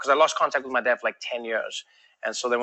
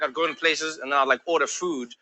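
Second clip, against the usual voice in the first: normal talking voice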